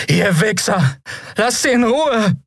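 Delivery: high-pitched furious scream; angry yell. A narrator's reading. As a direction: scream at them to leave her alone. Friend yells at the captors.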